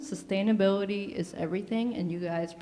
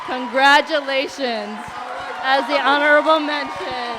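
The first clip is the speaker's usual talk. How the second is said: loud, high, hyped-up shouting; fashion show emcee; 6 semitones higher, 10 dB louder, slower than usual. Real, not acted. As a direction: hype the winner down the runway. Emcee calls winner onto runway.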